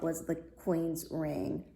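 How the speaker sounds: ordinary delivery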